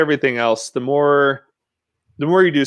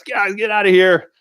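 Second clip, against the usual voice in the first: higher mock yell of an angry manager; retold yell; 7 semitones higher, 3 dB louder than usual. Manager chasing street riders off his property, retold mild yell. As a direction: imitate a manager yelling people off, not at full volume. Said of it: "just starts yelling at us".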